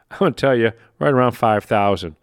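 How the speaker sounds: normal talking voice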